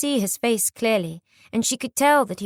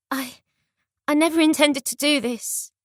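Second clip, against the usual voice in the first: higher, trembling and halting; shaky voice; 6 semitones higher than usual. A narrator's reading. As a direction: stammer it out, trembling. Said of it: "she answered in a shaky voice".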